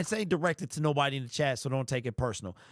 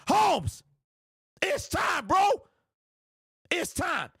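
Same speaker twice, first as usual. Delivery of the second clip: furious shouted rant; 7 semitones higher than usual. Real, not acted. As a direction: rant at the top of your voice, boiling over. Furious rant at GM Brad Holmes.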